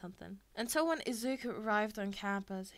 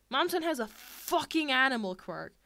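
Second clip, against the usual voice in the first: higher, louder snarling shout; angry shout; 4 semitones higher, 8 dB louder than usual. A narrator's reading. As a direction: bark it at the class. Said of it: "Bakugo shouted".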